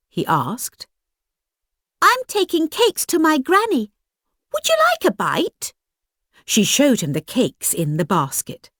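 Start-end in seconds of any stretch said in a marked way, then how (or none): 2.0-5.7 put-on high, bright little girl; chirpy young girl, eager to share.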